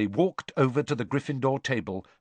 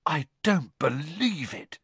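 stunned disbelief, pitched up and slow; 5 semitones higher than usual. Acted. Ron at the Durmstrang students, stunned and slow. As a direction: Ron staring in shock as the Durmstrang party passes. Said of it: "Ron said in a stunned voice".

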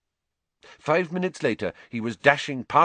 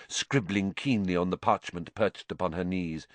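ordinary delivery, nothing marked

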